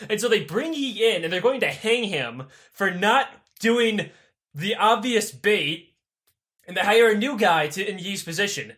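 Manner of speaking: ordinary talk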